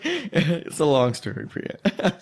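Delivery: slow, laughing, fading to breath; laughing sheepishly at the roommate. Retold or imitated. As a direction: let the laugh take over the line.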